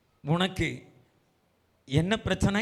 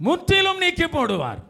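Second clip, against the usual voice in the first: very high, ringing preacher's cry; high proclamation; 13 semitones higher, 4 dB louder than usual. Real, not acted. Preacher promises shame will be removed, crying out. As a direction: send the promise soaring. Same promise cried, then lowered.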